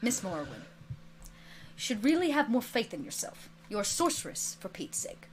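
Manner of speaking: low, soft, restrained teacher's rebuke; hushed, measured reproof; simply said. A narrator's reading. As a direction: chide gently and quietly, fading away. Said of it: "you hear him simply say".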